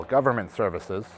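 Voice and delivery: projected roll-call of names; list announcer. Real, not acted. Host reading out a list of companies, announcing. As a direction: call out each company name with weight.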